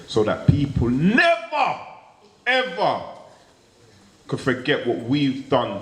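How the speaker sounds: stretched, rising never ever; drawn-out never ever. Real, not acted. Comic closing his set, stretching never ever.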